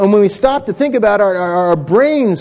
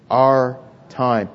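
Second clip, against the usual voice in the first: slow lead-in; 6 semitones lower than usual. Shown as a demonstration. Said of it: "Let's say that together".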